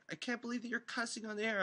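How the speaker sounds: mock a shocked woman caller, way up high; girl caller imitation. Imitating a shocked girl calling the station, high. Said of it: "she's like".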